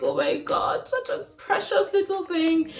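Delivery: high, breathy adoring words; tender awe. Retold or imitated. Mother gazing at her newborn, high and adoring. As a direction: coo it at the baby. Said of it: "I look at her like".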